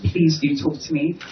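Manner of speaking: usual speaking voice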